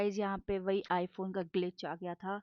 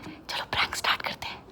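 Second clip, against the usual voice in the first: whisper. Real, not acted. Whispering before starting prank.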